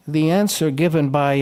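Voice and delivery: normal talking voice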